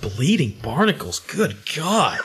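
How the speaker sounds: understated horror at someone else's oath; appalled reaction. Retold or imitated. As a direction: repeat it back in mock disbelief. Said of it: "give me this awful look like".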